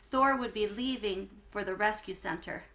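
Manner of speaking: plain reading voice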